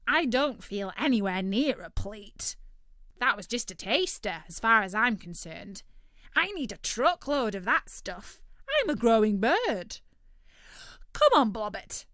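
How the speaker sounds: greedy turkey, politely thanking; loud turkey voice. Acted. Turkey begs loudly.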